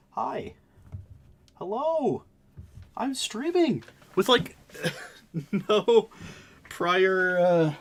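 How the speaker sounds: high, loud, strained from the cold; ice bath breathing. Real, not acted. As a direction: gasp the words out freezing. Ice bath greeting, freezing.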